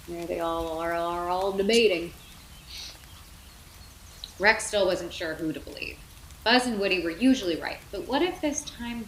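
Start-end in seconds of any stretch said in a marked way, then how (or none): none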